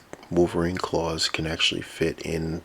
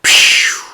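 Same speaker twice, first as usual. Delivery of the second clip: mouth blaster sound; 14 dB louder than usual. Acted. Weapon firing noise.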